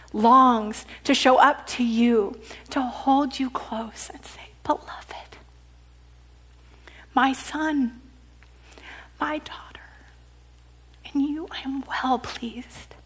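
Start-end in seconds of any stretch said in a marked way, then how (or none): 2.7-9.6 soft whisper, much quieter; intimate whisper. Acted. Preacher voicing Jesus to the listener in a whisper. Sermon, Jesus speaks tenderly. 11.1-13.0 soft whisper, much quieter; intimate whisper. Acted. Preacher voicing Jesus to the listener in a whisper. Sermon, God's words of pleasure.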